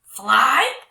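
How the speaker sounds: loud whispered-roar question; troll roar. Acted. Trolls roar at the girl's claim, loud. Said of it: "the trolls roared".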